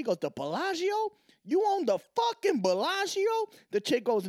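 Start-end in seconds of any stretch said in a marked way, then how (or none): 0.2-3.2 very high, loud incredulous squeal; hammed-up shocked customer; astonished disbelief. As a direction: shriek it in disbelief. Joke, guy astonished at casino.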